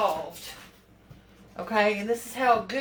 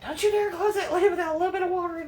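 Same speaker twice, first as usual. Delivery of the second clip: higher, fast, louder scolding mother; mother's mock scolding; 8 semitones higher, 4 dB louder, faster than usual. Daughter voicing her mother scolding over the lid, high and fast. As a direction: rattle it off like a fussing mom. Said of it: "My mother is screaming in my ear".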